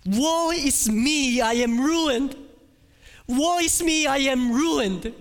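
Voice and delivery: high, loud, slow wail of ruin; anguished cry. Acted. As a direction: cry out in despair before the throne. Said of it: "all you will be able to say".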